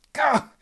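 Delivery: sharp high startled yelp. Acted. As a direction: yelp in shock. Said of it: "I yelped".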